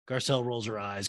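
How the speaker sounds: normal talking voice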